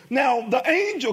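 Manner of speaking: usual speaking voice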